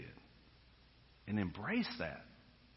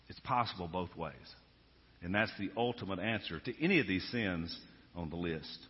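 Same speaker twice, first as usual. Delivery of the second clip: ordinary delivery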